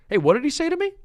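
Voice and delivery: high, affronted retort; indignant challenge. Acted. Indignant skit character answers an overheard remark, high-pitched indignant challenge.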